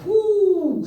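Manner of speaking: high shuddering whoo of disgust. Real, not acted. Comic thinking of bed bugs, high shuddering whoo. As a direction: recoil at the thought.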